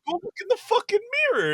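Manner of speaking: high, fast mother's order; bossy mom. Retold or imitated. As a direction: snap the order out like his mom. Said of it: "And she goes".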